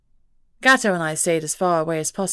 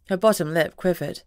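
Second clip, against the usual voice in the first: ordinary delivery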